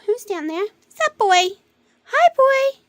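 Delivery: high, loud cooing hello to a pet; pet talk. Real, not acted. Greeting a pet.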